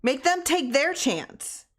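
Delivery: high, slow insistence; insisting. A narrator's reading. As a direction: push hard, pleading. Tessie pleads after yelling.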